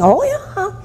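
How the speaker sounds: high, gleeful child imitation; happy kid. First grader answering a teacher, imitated happy squeal. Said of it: "he was so happy".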